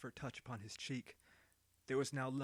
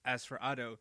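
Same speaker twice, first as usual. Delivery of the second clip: ordinary delivery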